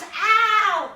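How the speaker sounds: sharp yelp of pain; sudden high ow from a stubbed toe. Real, not acted. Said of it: "I just stubbed my toe".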